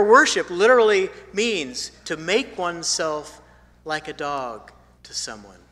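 usual speaking voice

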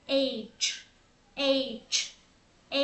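"H" drawn out, ending in a clear ch sound; letter H said slowly. Shown as a demonstration. Teacher contrasting "H" with A and eight, slow ch ending. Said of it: "mình đổi âm đuôi thành".

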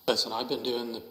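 ordinary talk, nothing marked

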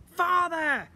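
excited slime creature; excited exclaim. Acted. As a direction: shout it with delight. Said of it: "exclaimed Slime".

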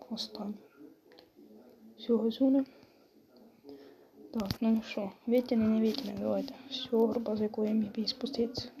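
hushed, half-whispered commentary; speaking quietly. Real, not acted. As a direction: keep it down so dad next door isn't disturbed. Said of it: "Говорив тихо, тому що в кімнаті".

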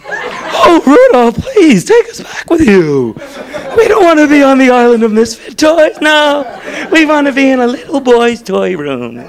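high, slow swishy pleading voice; effeminate Charlie. Retold or imitated. Charlie in the Box begging Rudolph to take them along, high camp plea. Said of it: "He's like".

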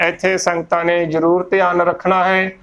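ordinary talk, nothing marked

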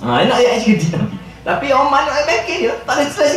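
laughing aloud. Real, not acted. Laughing at his own story.

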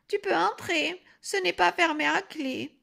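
high, quavering granny; old grandmother voice. A narrator's reading. Grandmother tells the wolf to come in, old quavering voice. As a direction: call back in a little old voice. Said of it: "une petite voix, toute vieille et chevrotante".

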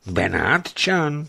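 Benátčan? asked slowly, astonished; surprised echo. A narrator's reading. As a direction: repeat the word in disbelief. Official echoes the name.

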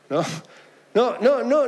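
ordinary talk, nothing marked

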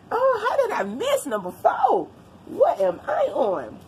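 high, loud startled exclamation; sudden alarmed surprise; surprised. Real, not acted. Realises she skipped a number.

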